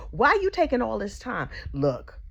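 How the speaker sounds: normal talking voice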